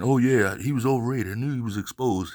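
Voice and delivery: high, loud sneering fan voice; mock a smug know-it-all; mocking quote.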